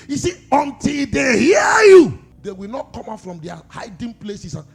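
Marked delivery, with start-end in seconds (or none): 0.1-2.2 high, loud, slow shouted preaching; roaring pulpit shout; full-force preaching. Acted. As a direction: cry it out to the congregation.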